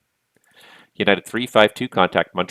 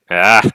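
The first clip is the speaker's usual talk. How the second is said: frustrated yell; 3 semitones higher, 11 dB louder than usual. Real, not acted. Overwhelmed by aircraft logging in.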